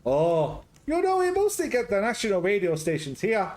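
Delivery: high, loud hammed-up Greek-Scottish accent; a jolly Greek cook with a Scottish lilt, up high and loud; garbled Greek-Scottish accent. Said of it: "Garbled Greek Scottish".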